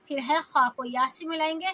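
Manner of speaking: normal talking voice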